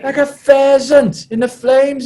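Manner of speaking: Adam Jones. Retold or imitated. Prop repeating his climax to the team, high hammed-up yell. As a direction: push the repeat right up high, drawn out. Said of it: "he repeated".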